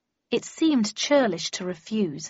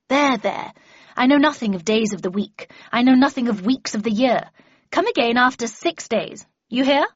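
put-on louder, higher, impatient old recluse; imperious Miss Havisham; 4 semitones higher, 7 dB louder than usual. Miss Havisham silences Pip's talk of days, imperious and loud. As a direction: cut the visitor off with commanding force.

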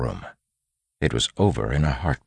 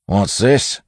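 loud, raised bandit voice; bandit calling out; 7 semitones higher, 7 dB louder than usual. A narrator's reading. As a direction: call roughly to the gang. Second bandit finds notepad.

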